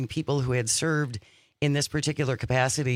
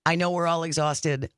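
normal talking voice

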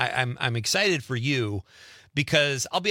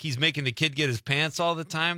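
ordinary talk, nothing marked

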